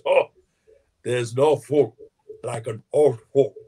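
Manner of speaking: put-on low South African elder's accent, measured delivery; Nelson Mandela. Storyteller quoting Mandela greeting guests, in his South African accent. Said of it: "in the Mandela voice".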